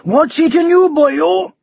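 high, loud yawning call. A narrator's reading. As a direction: yawn the words out loudly. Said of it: "Jones the Jet yawned hugely".

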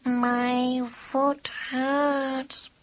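high, very slow kitten whine. A narrator's reading. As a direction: drag it out like a hurt kitten. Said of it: "still in a kitten's voice".

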